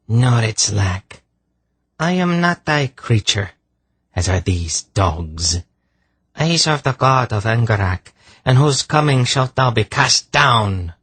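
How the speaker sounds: high, scornful defiance; contemptuous. A narrator's reading. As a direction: defy the false god with contempt. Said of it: "in a voice filled with contempt".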